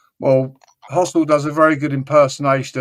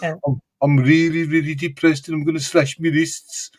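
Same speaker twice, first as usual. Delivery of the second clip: mopey Birmingham drone, imitating; depressive Brummie accent; 3 semitones higher than usual. Mocking a gloomy Brummie accent for friends. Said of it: "manic depressive".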